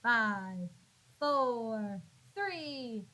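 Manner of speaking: usual speaking voice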